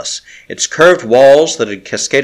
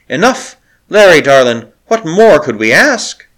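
puzzled; 5 semitones higher, 4 dB louder than usual. Acted. Puzzled handmaiden answers lovingly.

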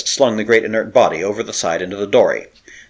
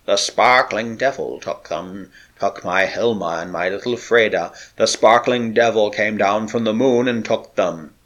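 croaking. A narrator's reading. Broken sailor telling of his lost family, croaking. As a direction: drag the words out like a haunted man. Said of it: "croaked Olaf Huldriksen".

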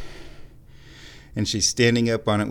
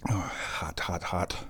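whispered, much softer gasps of "hot"; whispered "hot, hot" over scalding tea; hot-drink whisper; 5 semitones lower, 9 dB quieter than usual. Real, not acted. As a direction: breathe it out after burning your mouth. Sipping tea that's too hot.

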